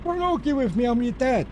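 high-pitched mock indignation, imitating himself; mock-stern dad. Dad retelling a squabble over the Xbox with his kids, mock-indignant. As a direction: pull rank in a high, exasperated play-argument voice. Acts out dad ending kids' argument.

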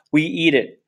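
usual speaking voice

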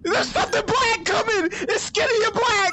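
frightened, high and frantic; being chased. Real, not acted. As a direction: cry it out while fleeing in terror.

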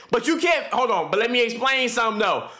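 ordinary talk, nothing marked

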